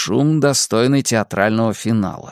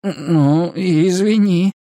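meek, slightly raised, wavering sorry; bleated apology; 6 semitones higher, much slower than usual. A narrator's reading. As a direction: bleat it out sheepishly. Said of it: "проблеял я".

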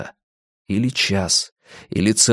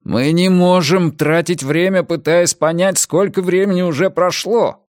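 higher, urgent protest; raised voice pressing the point home; exasperated; 7 semitones higher, 3 dB louder than usual. A narrator's reading. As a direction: insist with mounting frustration. Same protest repeated in panic.

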